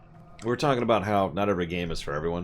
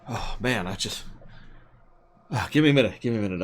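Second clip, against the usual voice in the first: out of breath; 3 semitones higher, 3 dB louder, faster than usual. Pretend. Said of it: "let me catch my breath".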